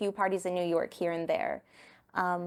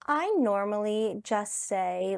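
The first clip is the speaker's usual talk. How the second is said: ordinary talk, nothing marked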